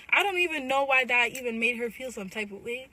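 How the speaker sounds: ordinary delivery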